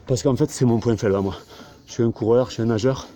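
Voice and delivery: strained, talking mid push-up; doing push ups. Real, not acted. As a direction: speak while pushing through reps. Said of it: "Je vais terminer par des pompes".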